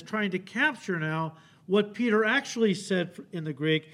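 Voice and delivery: usual speaking voice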